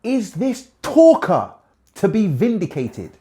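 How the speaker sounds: high, loud, slow scornful question; a friend scoffing at Job, loud and high; annoyed Zophar. Acted. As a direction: lean hard on talker with contempt. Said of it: "He goes".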